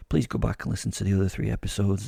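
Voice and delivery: normal talking voice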